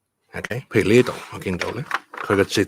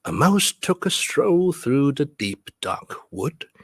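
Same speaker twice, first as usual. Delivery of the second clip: lilting beats on "mouse", "stroll" and "wood"; rhythmic verse reading; 6 semitones higher, slower than usual. Shown as a demonstration. Teacher showing how stresses sound, lilting beat on "mouse" and "stroll". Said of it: "it's gonna sound like this".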